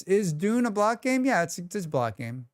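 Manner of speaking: usual speaking voice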